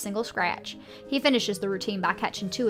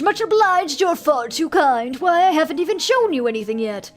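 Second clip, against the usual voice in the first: louder, higher smooth ringmaster; showman; 6 semitones higher, 6 dB louder than usual. Acted. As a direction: bask in the applause like a showman. Showman thanks crowd.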